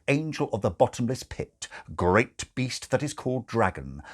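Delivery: usual speaking voice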